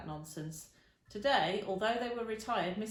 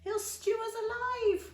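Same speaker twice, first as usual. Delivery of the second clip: put-on much higher, louder and slow, a child's drawn-out wail; 5 dB louder, slower than usual. A narrator's reading. Second boy wails about being stewed, slow high wail. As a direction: wail it like a terrified small boy. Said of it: "wailed the second one".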